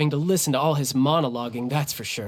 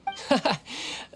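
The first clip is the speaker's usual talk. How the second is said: quiet breathy chuckle; a dismissive little laugh; soft laugh; 7 semitones higher, 6 dB quieter than usual. Acted. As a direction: laugh it off lightly. Childe brushes off concern.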